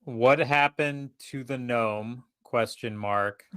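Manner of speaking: very slow, word-by-word dictation; dictating a text. Pretend. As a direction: spell it out slowly as if typing. Dictating a text message, punctuation included.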